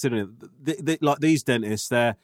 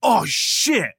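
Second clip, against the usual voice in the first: raised, louder startled cry; dentist's panicked exclamation; 6 semitones higher, 4 dB louder than usual. Retold or imitated. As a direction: blurt it out in alarm. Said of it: "He just goes".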